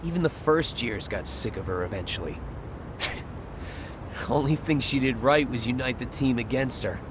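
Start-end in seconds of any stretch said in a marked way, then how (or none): 2.4-4.2 breathy snicker. Acted. Sneering about the klutz.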